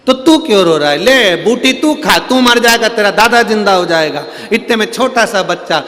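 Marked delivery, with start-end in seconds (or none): 0.1-4.2 scolding. Acted. Guru scolds and offers herb.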